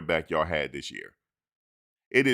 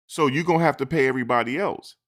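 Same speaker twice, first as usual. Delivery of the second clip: raised, higher demand; mock demand; 7 semitones higher, 5 dB louder than usual. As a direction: push back loudly. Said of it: "these players are saying".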